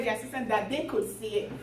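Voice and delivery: ordinary delivery, nothing marked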